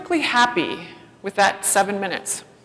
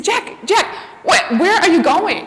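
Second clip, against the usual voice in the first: much higher, calling out Jack, Jack; calling after son; 8 semitones higher, slower than usual. Retold or imitated. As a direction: call him back before he runs off. Mom calling after her son.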